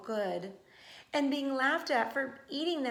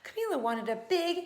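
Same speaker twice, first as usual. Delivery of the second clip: ordinary delivery, nothing marked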